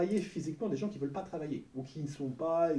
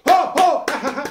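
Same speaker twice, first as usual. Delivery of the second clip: "Ho, ho, ha, ha, ha" shouted very loud and high; 12 semitones higher, 17 dB louder than usual. Shown as a demonstration. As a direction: belt it full force. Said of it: "quand fort". (ho ho ha ha ha crié fort)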